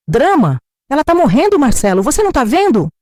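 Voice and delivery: high, loud outraged shout. Acted. Daughter yells at her brother for ignoring their dying mother, outraged shout. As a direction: hurl it back at him in disbelief. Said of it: "A Laura gritou".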